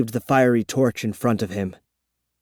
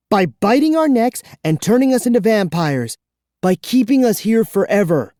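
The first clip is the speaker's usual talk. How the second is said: angry narration; 7 semitones higher, 3 dB louder than usual. A narrator's reading.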